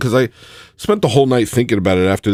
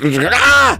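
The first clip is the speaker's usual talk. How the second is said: high, louder yell of frenzy; mock yell; 8 semitones higher, 3 dB louder than usual. Real, not acted. Streamer tearing at his shirt yells. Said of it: "rip open my shirt".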